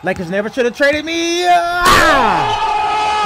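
hammed-up anguished shout; anguished outburst. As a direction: cry out like betrayed. Acted falling-out rant.

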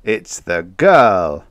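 slow, loud reveal; knowing. A narrator's reading. Joker revealing what bothers outpost man, knowing. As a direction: draw it out with emphasis. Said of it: "Town said wisely".

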